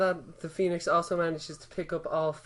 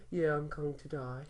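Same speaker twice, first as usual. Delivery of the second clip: slow, softer, offhand; mock nonchalant Harry; casual dying Harry; 6 dB quieter than usual. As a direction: shrug off death lazily. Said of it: "He's like".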